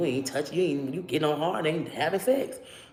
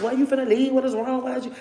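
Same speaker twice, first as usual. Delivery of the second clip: begging; 8 semitones higher than usual. Retold or imitated. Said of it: "I'm begging".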